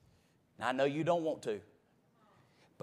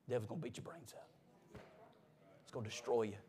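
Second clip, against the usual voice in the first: much softer, lower ominous warning; dropped to a hush; 5 semitones lower, 14 dB quieter, faster than usual. Acted. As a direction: pull it down to almost nothing. Warning drops to near nothing.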